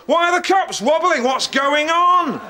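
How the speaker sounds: high, hammed-up alarmed shout; alarmed character. Retelling a film scene to friends, mock alarmed shouting. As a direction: act out a panicked man demanding answers. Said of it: "comes in and goes".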